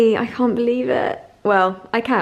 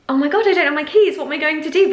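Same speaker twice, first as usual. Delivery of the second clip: higher, fast retold panic; panicked; 6 semitones higher, 3 dB louder, faster than usual. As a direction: replay the moment of locked-out panic. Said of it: "and I was just like".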